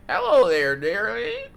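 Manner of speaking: put-on high, loud old granny; grandma voice. An old granny at the door, high and croaky. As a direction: croon it like a sweet old granny. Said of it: "My grandma voice kinda mid".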